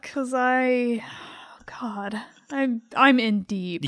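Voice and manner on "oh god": whispering oh God; under the breath, unvoiced; whispered aside. Real, not acted. Embarrassed aside.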